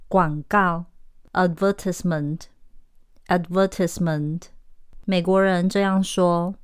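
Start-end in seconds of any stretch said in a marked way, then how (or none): 1.3-4.4 slow British "advertisement", stress on VER; British pronunciation. Shown as a demonstration. Teacher models the word for learners, British accent. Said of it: "英国人说的是advertisement".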